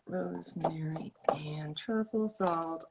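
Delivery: slow soft whisper of "Rosemary and truffle salt". Shown as a demonstration. Reads label name, then whispers it.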